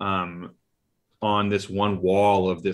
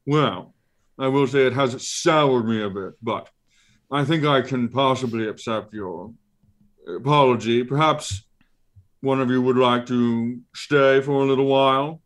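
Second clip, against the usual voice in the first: higher, fussy monster voice musing aloud; Ralakor creature voice; 4 semitones higher than usual. Acted. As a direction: muse like a pompous eye creature.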